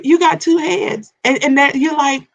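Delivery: usual speaking voice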